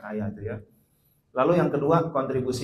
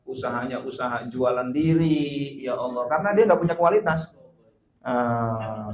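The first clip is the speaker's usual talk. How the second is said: ordinary talk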